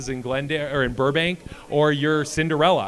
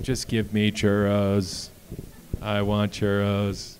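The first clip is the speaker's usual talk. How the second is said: mock craving; 5 semitones lower, 3 dB quieter, slower than usual. Audience imagined craving churros.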